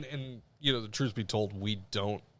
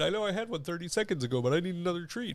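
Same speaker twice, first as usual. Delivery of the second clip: high-pitched pleading pet; talking dog voice; 9 semitones higher than usual. Acted. Dog begging its owner for another treat, high silly voice. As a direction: beg like a needy, dim dog. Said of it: "I'll talk in the dog's voice".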